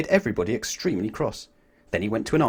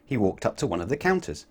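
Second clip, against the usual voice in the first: plain reading voice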